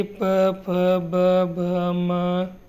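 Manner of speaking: प फ ब भ म said separately, each spelled out; labial sound demo. Shown as a demonstration. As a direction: model the lip sounds प, फ, ब, भ, म. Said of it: "आप करके देखिए".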